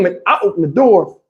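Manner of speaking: usual speaking voice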